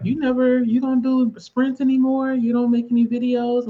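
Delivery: lightly voiced family complaint; complaining kids. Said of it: "They were like".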